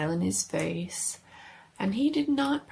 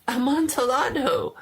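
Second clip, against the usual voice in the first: louder, higher, hungry for the wine; eager exclamation; 8 semitones higher, 5 dB louder than usual. Acted. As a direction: blurt the word in excitement. Fortunato repeats the wine name.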